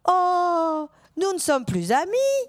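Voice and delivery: high, slow, wounded robot voice; mock heartbroken robot. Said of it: "Et là, je fais". (imitant le robot vexé, aigu et lent)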